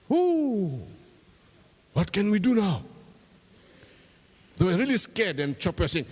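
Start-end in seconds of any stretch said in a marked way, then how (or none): 0.1-2.8 high startled whoo, then a worried question; frightened cry. Acted. Boys caught after the bird flew away, frightened. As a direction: jump with fright and ask for help. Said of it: "They were scared".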